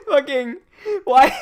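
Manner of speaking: high-pitched, laughing squeal of disbelief; helpless, loud, high laughing outburst; cracking up. Real, not acted. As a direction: squeeze the words out through real laughter. Breaks up laughing at a chat name.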